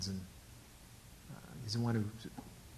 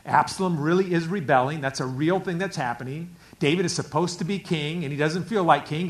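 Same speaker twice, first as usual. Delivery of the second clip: ordinary talk, nothing marked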